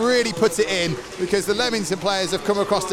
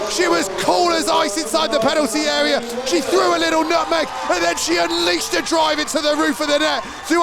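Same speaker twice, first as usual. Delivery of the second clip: live play-by-play; 8 semitones higher, 3 dB louder than usual. Acted. Replay description after the goal.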